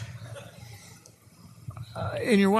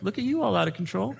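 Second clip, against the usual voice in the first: condescending; 5 semitones higher than usual. Retold or imitated. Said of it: "I look at her like".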